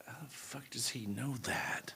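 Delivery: hushed whisper. Retold or imitated. To friend Eddie about the agent, a shocked whisper. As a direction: whisper it, unvoiced and slow.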